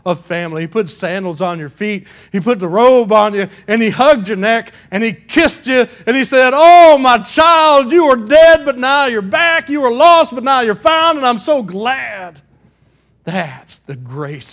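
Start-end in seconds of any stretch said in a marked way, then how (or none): 2.3-6.5 loud, emphatic pulpit preaching. Real, not acted. Preaches at full force. 6.5-12.4 loud, high cry of welcome; overjoyed father. Acted. Voices welcoming father of prodigal.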